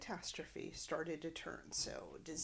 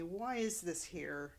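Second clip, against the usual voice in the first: ordinary talk, nothing marked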